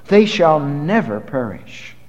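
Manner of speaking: ordinary talk, nothing marked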